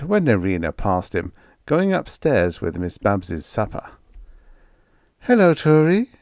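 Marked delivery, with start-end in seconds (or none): 5.2-6.1 high, loud, rising cheerful hello; a sing-song passing greeting; friendly maid greeting. A narrator's reading.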